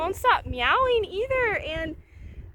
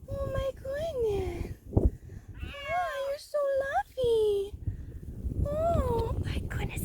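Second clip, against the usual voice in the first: cooing to a kitten; 5 semitones higher than usual. Real, not acted. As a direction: gently coax a frightened cat out, voice raised high.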